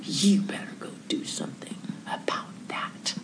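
low whispered reproach; hushed whisper. Retold or imitated. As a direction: hiss a quiet order at your husband. Whispered scolding of husband.

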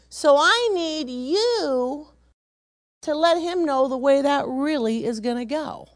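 slow, emphatic, high-pitched mock indignation; hammed-up indignant grandma, stretching the words; exasperated explaining. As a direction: spell it out slowly to the police, exasperated. Acted explanation to the officers.

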